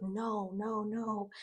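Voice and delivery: a quiet horrified no; mock-shocked whispery no; stunned denial. Kat reacts to shocking news.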